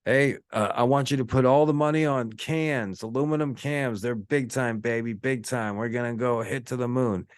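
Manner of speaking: mock big-shot investor bragging, pitched high; hyped-up stock trader. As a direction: play a guy in a suit hyping a stock tip on the phone. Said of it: "and he's like".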